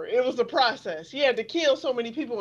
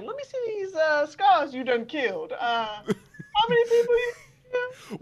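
mock weepy plea; 8 semitones higher than usual. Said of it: "I was like".

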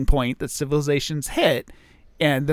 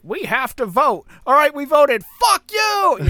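acted angry crowd, rising to a high shout; hammed-up voter outrage, loud and climbing; mock defiance; 12 semitones higher, 6 dB louder than usual. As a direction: build from loud protest to a yelled insult. Acted angry voters defying the aliens.

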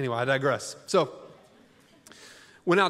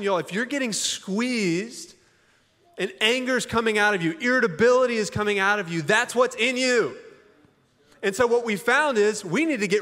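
raised, driving sermon voice; forceful preaching; 6 semitones higher than usual. Acted. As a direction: push it hard like a pastor at the climax.

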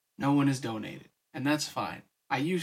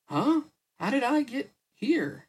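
high-pitched, bewildered little character voice; higher, dazed and questioning; confused murmur; 10 semitones higher, 4 dB louder than usual. A narrator's reading. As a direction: pipe up in a high, puzzled voice. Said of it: "Vivi murmured".

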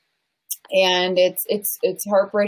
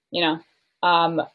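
usual speaking voice

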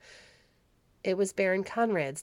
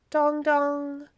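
bell imitation; 9 semitones higher, 6 dB louder than usual. A narrator's reading. Bell sound imitated in story.

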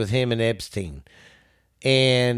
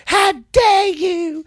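mock outraged girl, much higher and slow; mocking Greta's speech; 3 dB louder, slower than usual. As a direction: shriek it up high like the teen activist. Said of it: "that nineteen-year-old girl that did that speech".

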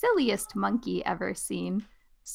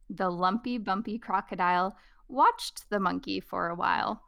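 usual speaking voice

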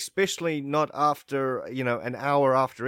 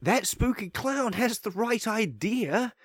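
high silly inner voice; 8 semitones higher than usual. Retold or imitated. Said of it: "I think to them, myself".

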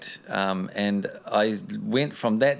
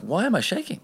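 much higher, startled inner question; alarmed thought; 11 semitones higher than usual. Retold or imitated. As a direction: ask yourself in sudden alarm. Retells his own alarmed thought.

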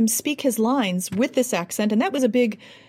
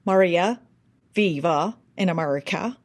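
Maria viva in America with a thick Irish R; Spanish said through Irish sounds; Irish-accented Spanish. Shown as a demonstration. Coach shows Irish-flavored Spanish, lightly voiced. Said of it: "speaking Spanish as though you're using the sounds of Irish".

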